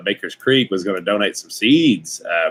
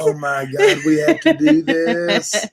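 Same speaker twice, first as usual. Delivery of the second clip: put-on much higher, slow, gushing kid voice; excited kid; slower than usual. Dad imitating son's reaction to the shout-out, excited squeal. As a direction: squeal it like an overjoyed child. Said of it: "He was so excited".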